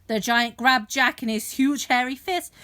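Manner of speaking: ordinary delivery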